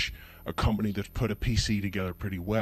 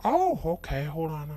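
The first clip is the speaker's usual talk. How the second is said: high chirpy clerk voice; mock salesperson, raised pitch; Apple store clerk; 10 semitones higher than usual. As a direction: play the eager helper up high. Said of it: "clerk handing over a computer".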